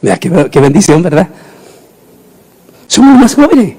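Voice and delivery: ordinary talk, nothing marked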